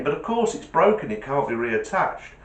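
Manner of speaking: ordinary delivery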